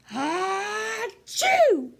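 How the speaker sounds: loud, high, hammed-up sneeze; big sneeze. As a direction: let the bear blast out a sneeze. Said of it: "He blows and he sneezes".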